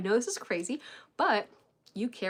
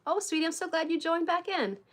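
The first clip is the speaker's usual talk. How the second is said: calm mom voice; 9 semitones higher than usual. Shown as a demonstration. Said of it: "keep it really calm, matter of fact, really neutral".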